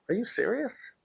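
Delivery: high, disbelieving retold question; incredulous. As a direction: ask it in high-pitched disbelief. Retelling his reaction to the request.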